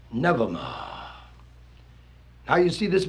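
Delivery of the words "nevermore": ominous raven. Acted. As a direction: intone it like a doom bird. Raven's refrain.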